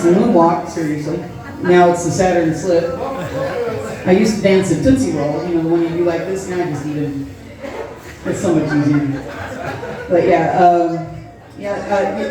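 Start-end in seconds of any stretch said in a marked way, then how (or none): none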